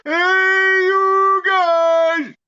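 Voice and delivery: long drawn-out high yell; calling out. Real, not acted. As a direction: holler across the woods. Calling out to distant friends.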